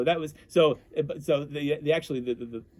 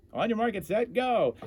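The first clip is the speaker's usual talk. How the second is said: race announcer call; 9 semitones higher than usual. Retold or imitated. Announcer starting the pierogi race, calling it out high. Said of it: "So Alex said".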